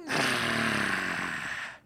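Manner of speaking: low, rumbling grunt; the miner's deep-voiced Muki turning back. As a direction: answer like a gruff little creature. Muki turns around, deep voice.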